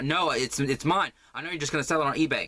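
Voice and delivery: snatch it back from a reseller; hammed-up yarn squabble; mock possessive fight. Acted fight over yarn.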